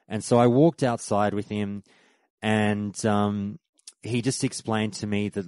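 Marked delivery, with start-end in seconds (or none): none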